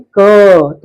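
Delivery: क drawn out with its full vowel अ; full-vowel letter. Shown as a demonstration. Teacher shows pupils the Hindi letter, drawn-out क. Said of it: "उसके उच्चारण में जैसे बोलते हैं".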